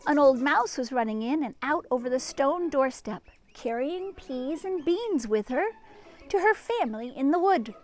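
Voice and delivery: lively storybook read-aloud; reading to children. A narrator's reading. Librarian reading to children, lively storybook voice. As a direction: read it like a picture book to kids.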